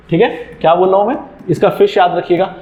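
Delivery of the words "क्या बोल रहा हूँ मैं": ordinary talk, nothing marked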